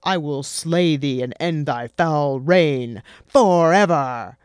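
slow, loud, grand hero voice; hammy hero declaiming at the monster; pompous hero. Acted. Marduk booming grandly at the monster. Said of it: "he said".

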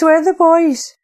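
high, loud, frantic call repeated; panicked cry. Acted. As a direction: cry it again, desperate. Frantic search for her brothers.

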